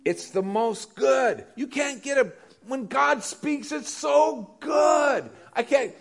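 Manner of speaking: high, loud, slow preaching build; full-force preaching. Acted. Pastor lifts the congregation about God's voice, high slow preaching shout. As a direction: stretch it out like a revival peak.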